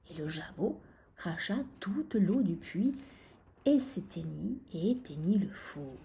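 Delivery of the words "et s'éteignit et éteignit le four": ordinary delivery, nothing marked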